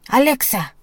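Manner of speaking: high giant roar. A narrator's reading. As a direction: bellow the name. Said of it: "rugió el gigante de los datos".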